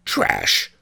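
low, curt sneer. A narrator's reading. One-Eyed Wong belittling the earring, low sneer. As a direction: spit it out with contempt. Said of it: "sneered One-Eyed Wong".

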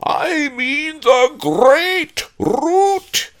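slow, very loud, high haunted roar; spectral roar. A narrator's reading. Patron of ginseng correcting the cowering pawnbroker, drawn-out roar. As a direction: roar it word by word as a vengeful ghost. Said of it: "roared the patron of ginseng".

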